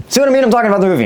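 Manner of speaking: high-pitched, choked-up protest; getting emotional. Real, not acted. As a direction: voice jumps up high, overcome with real emotion. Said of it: "I'm getting emotional".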